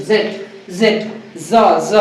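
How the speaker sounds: जेट with a clear voiced z-like ज; stressing the j sound. Shown as a demonstration. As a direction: isolate ज, ज for the class. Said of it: "ज sound आना चाहिए". (ज ध्वनि पर ज़ोर देकर उच्चारण)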